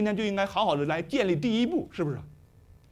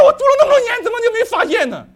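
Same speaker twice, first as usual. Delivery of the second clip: high, loud wail of disbelief; hammed-up astonished lament; mock dismay; 12 semitones higher, 7 dB louder, faster than usual. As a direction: cry out in regret at the lost treasure. Voicing seller's regret.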